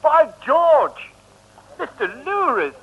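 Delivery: loud, high startled cry of surprise; surprised exclamation. Acted. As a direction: blurt it out, thrilled to see him. Englishman startled, meets old friend. (luide, hoge verraste uitroep)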